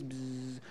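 low buzzing clipper noise. Retold or imitated. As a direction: buzz through the lips like hair clippers. Imitating shaving clippers.

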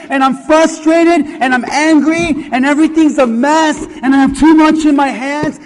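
loud, aggrieved listing of what failed; frustrated complaint. Retold or imitated. A frustrated believer complaining to God, loud grievance.

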